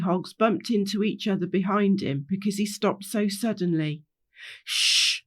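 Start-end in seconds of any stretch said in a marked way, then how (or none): 4.6-5.2 long unvoiced shush; hushing shh. Rainbow silencing everyone at a noise, sharp shh. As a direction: hush the group with a shh.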